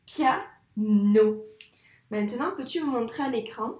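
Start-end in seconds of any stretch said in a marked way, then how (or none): 0.0-1.4 piano as pia, pause, no, firmly; syllable by syllable. Shown as a demonstration. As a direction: give each syllable of piano its own loud beat. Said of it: "un rond pour chaque syllabe". (piano bien découpé : pia - no)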